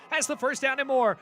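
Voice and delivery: loud high excited play call. Real, not acted. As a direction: ride the run with rising energy. Long gain called live.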